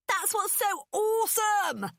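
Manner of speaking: high, slow, loud delighted cry; excited shout. A narrator's reading.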